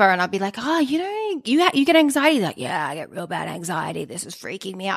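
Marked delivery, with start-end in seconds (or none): 0.6-2.4 high, feigned wide-eyed interest; mock surprise. DJ feigning surprise at a partygoer's anxiety, high mock interest. Chatting up stranger at gig. 2.6-5.0 soft, small mock-anxious voice; imitating a nervous guest. Anxious partygoer confessing to DJ, soft mock worry.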